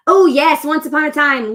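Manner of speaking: light up at a remembered favourite; excited. Real, not acted. Suddenly remembering a favourite show.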